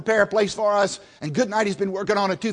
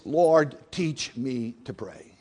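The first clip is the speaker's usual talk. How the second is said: earnest prayer; 4 semitones lower, much slower than usual. Retold or imitated. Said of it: "it went like this".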